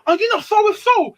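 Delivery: high imitated echo of a greeting; surprised recognition. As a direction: pitch it up, mimicking delighted recognition. He goes.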